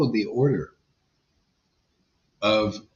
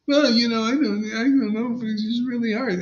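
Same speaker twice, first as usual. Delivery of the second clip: higher, plaintive student voice; mimicking a student; 10 semitones higher than usual. Teacher imitating the student's hesitant excuse. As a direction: whine it like the unsure student. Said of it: "one of my students yesterday said".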